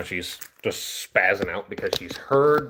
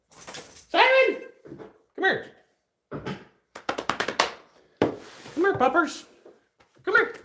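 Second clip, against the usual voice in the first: high dog-calling voice. Real, not acted. Owner calling her dog over, high coaxing voice. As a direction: call the dog in a much higher sing-song.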